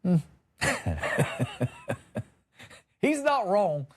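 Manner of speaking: normal talking voice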